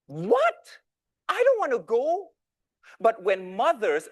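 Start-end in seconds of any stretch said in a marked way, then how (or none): 0.1-0.8 high, loud indignant "What?"; a spoilt kid balking at the order; shocked protest. Retold or imitated. Son to mom, a high indignant yelp. Reacting to being sent to orphanage. 1.3-2.3 high, loud sulky protest; whiny refusal. Retold or imitated. Son refusing his mom, high and whiny.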